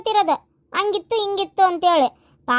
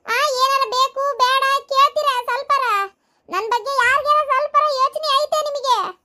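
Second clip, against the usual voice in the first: higher, whiny mother-in-law voice; nagging mother-in-law; 7 semitones higher than usual. Acted. Mother-in-law scolding idle family, higher whiny nag. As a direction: grumble like a neglected mother-in-law.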